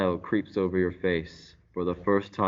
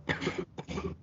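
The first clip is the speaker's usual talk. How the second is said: cough; 9 dB quieter than usual. Acted.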